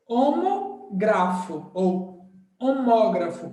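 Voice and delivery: homógrafo said slowly, stress held on the syllable mó; careful stress demo. Shown as a demonstration. Teacher showing students where homógrafo is stressed, slow and careful. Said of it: "Como essa sílaba tá acentuada".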